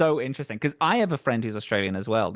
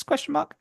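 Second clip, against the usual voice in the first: "question mark" pitched much higher, as a doubtful query; questioning rise; 13 semitones higher than usual. Acted. GM unsure of their own accents, "question mark" said high and questioning.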